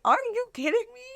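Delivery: high, mock-outraged are you kidding me; mock disbelief. Retelling her shocked reaction.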